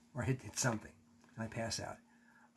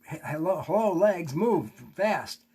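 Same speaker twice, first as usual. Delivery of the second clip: urgent calling; 4 semitones higher, 7 dB louder, slower than usual. Retold or imitated. Urging paralysed legs to move.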